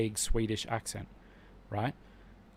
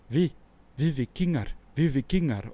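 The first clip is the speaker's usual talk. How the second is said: a lightly put-on Swedish accent, a bit higher; mock Swedish; 4 semitones higher, 5 dB louder than usual. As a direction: imitate the stiff Swedish line from the show. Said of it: "there's that scene on the beach where they're like".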